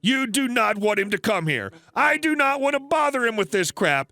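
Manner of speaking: angry customer rant. Retold or imitated. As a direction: keep hammering the clerk with it. Customer rants about husband.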